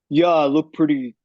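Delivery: lightly put-on cool-guy voice; hint of a guy acting cool; mock cool guy. Mock example of guy talk.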